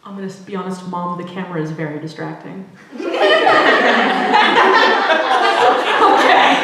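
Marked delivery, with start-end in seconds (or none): 2.7-6.0 long loud laugh; loud laughter. Real, not acted. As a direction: crack up laughing. Laughing at the camera remark.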